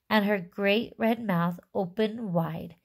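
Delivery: ordinary delivery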